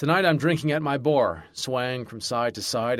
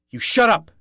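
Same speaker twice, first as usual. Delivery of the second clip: much higher and louder, explosive; yell; 10 semitones higher, 8 dB louder than usual. A narrator's reading. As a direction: blast it out to silence him. Yelling at bartender.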